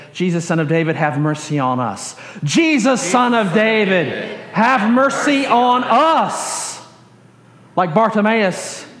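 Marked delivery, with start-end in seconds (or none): 2.4-6.8 slow, higher-pitched communal plea; group crying out. Shown as a demonstration. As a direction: cry out together, stretching every phrase. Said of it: "a group thing".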